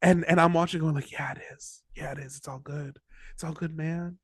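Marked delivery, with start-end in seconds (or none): 1.1-4.2 very soft, hushed agreement; barely-voiced, comforted echo; soothed murmur. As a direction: quietly reassure yourself along with him. Soothed viewer echoing the reassurance.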